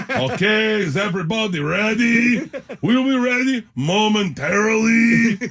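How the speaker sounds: high, slow, careful announcement voice; stage manager impression. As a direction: imitate the stage manager calling the set, drawn out. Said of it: "He talks like this".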